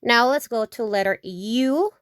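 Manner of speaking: usual speaking voice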